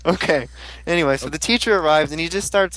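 ordinary talk

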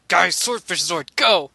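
hammed-up ranger battle cry; rally the team like a cartoon hero; mock hero call. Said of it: "he's all like".

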